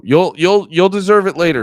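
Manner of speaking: half-joking threat at a chatter; mock threat.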